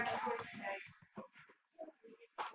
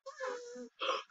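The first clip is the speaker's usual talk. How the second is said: frightened gasp; 9 semitones higher, 12 dB quieter than usual. Real, not acted. Hears footsteps.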